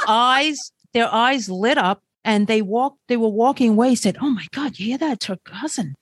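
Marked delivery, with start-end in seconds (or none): 4.2-5.9 softer, half-whispered awe; imitating hushed, amazed teenagers; hushed retold gossip. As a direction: drop to a whisper as if gossiping while walking away. Teens overheard while walking away.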